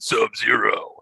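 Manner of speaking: a light, slightly off-voice take on a bar regular; imitating a generic beer guy. Host mimics co-host's bar-regular voice, lightly hammed. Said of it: "you were very clearly doing a guy who loves to drink all of the big generic".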